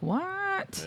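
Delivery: high, drawn-out incredulous "what"; mock bewilderment, high and stretched; baffled bystander. Retelling a baffled bystander's reaction.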